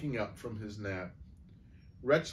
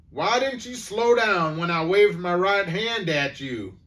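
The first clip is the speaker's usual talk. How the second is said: louder, higher yell; 7 semitones higher, 7 dB louder than usual. A narrator's reading. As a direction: shout it angrily at Eddie. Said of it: "he yelled".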